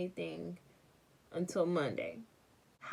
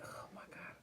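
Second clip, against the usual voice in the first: breathless whispered dread; a small whispered oh my God; 17 dB quieter than usual. Retold or imitated. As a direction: whisper it like the belt is coming. Dreading the coming whooping.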